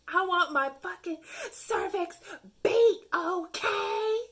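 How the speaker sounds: high, loud, drawn-out crude demand; mock demanding. Trolling a Tinder guy, a loud high hammed-up demand. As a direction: belt it out like a brazen diva.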